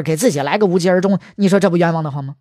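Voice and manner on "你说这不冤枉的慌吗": plain reading voice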